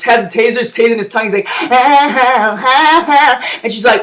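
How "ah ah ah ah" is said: choppy ah sounds like an electric jolt; jittery stuttered ahs; mock tased grunts. Chelios tasing his tongue, jolted stuttering ahs.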